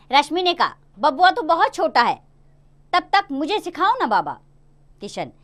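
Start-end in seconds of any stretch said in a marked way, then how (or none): 1.0-4.4 put-on higher, eager little girl's plea; pleading girl. Daughter urging her father, a touch louder and higher. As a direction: press him sweetly. Rashmi insists.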